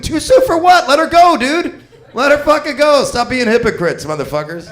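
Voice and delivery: high, loud exasperated rant. Real, not acted. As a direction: yell it out, fed up. Ranting at hypocrites.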